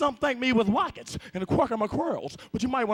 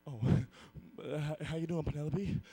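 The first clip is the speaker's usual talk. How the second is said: ordinary delivery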